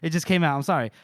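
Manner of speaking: usual speaking voice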